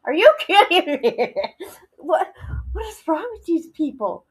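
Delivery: real laugh breaking through the words; laughing in disbelief. Real, not acted. Reacting to the YouTuber's cliff remark, laughing disbelief. Laughing at absurd clip comment.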